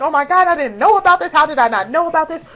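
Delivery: loud, high panic; freaking out. Retold or imitated. Fan missing news of an event, loud excited panic. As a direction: blurt it in a rush.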